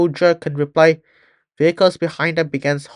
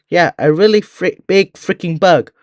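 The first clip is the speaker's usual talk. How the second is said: louder and higher, panicky; frightened soldier; 4 semitones higher, 5 dB louder than usual. Acted. Panicked soldier telling the team about the bug, loud and shaken. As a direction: blurt it out, scared of the bug.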